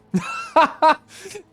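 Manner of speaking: sudden high burst of laughter, loud. Real, not acted. Laughing at chat joke.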